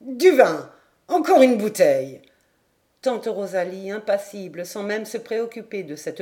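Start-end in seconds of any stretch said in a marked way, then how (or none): 0.0-2.2 loud tipsy call for wine; boisterous drunk ordering more; drunken shout. A narrator's reading. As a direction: shake off the tears and holler for a bottle. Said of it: "Puis hoqueta, surmontant cette faiblesse".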